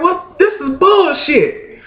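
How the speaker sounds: high-pitched squeak of disbelief; high outraged disbelief. Retold or imitated. Father hearing the clerk again, squeaking high and stunned. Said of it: "I'm like".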